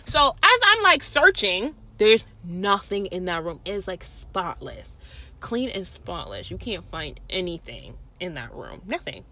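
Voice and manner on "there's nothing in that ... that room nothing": quiet, deflated. Retold or imitated. Retelling the empty room search.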